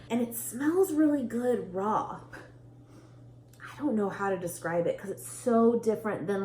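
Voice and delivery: usual speaking voice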